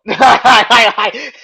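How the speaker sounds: high burst of laughter; loud laugh. Real, not acted. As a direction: let out a big laugh. Laughing at own skit.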